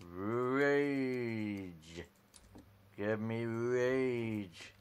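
hammed-up slow rage; mock menacing. Shown as a demonstration. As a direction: draw out rage like a villain. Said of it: "Ready, rage".

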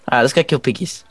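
ordinary delivery

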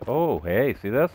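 ordinary talk

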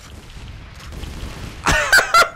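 high breathless incredulous laugh; disbelieving laugh. Real, not acted. Laughing at absurd rockets.

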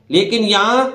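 ordinary talk, nothing marked

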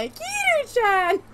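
high, loud, drawn-out name call; squealed call. Acted. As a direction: sing out the name in a long squeal. Mimics calling a character's name.

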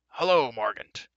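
slow, loud booming greeting; hearty roar. A narrator's reading. Giant king greets the war leader, roaring. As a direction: roar it out, stretching the words. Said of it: "he roared".

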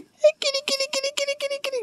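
very high soft kitty call. Real, not acted. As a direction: coax the cat in a tiny high voice. Calling cat sweetly.

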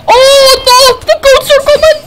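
high, loud cartoon panic; mock panic. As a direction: yelp as if goats stampede. Goats rush in during game.

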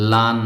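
লান said slowly and clearly so students can watch the mouth; model pronunciation. Shown as a demonstration. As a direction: show লান with a clear n at the end. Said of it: "আমার দিকে তাকাতে হবে কিভাবে আমি উচ্চারণ করছি". (লান স্পষ্ট করে দেখানো)

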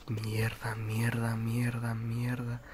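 whispered scared cursing; cursing under breath. Real, not acted. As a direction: mutter curses under your breath, scared. Scared alone in the dark forest. (maldiciendo en voz baja, con miedo)

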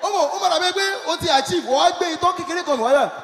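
ordinary delivery

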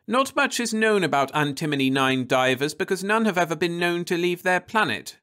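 put-on high young woman reading; Florence's voice. A narrator's reading. Wife reading from the encyclopedia, high voiced. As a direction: announce it in a high voice.

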